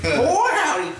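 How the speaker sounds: high-pitched, laughing Southern parody; mock Southern accent. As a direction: squeal it in a hammed-up Southern accent. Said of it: "The southern accents in this".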